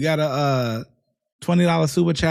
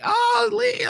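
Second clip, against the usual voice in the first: guy calling out. Retold or imitated. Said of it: "they gonna be like".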